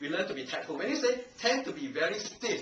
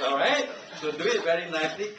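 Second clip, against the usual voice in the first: ordinary talk, nothing marked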